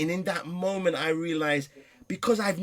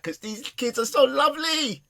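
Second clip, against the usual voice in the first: real laugh through the words, much higher; laughing fondly; 11 semitones higher, 7 dB louder than usual. Real, not acted. Laughing over his lovely kids.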